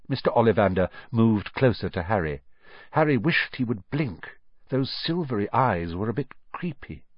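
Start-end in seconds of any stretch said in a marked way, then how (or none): none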